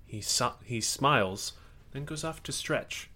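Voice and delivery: normal talking voice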